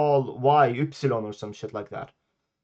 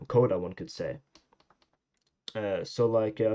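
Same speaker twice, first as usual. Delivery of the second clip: normal talking voice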